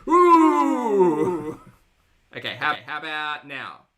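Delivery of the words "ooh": surprised ooh. Real, not acted. Startled by the echo.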